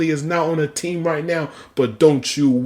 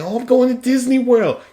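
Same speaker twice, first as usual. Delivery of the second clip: mock fan; 9 semitones higher than usual. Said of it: "everyone saying".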